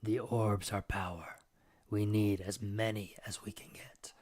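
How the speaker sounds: whispered demon voice. Acted. Demon whispering to the player about the orbs. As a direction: let it slip out like a secret.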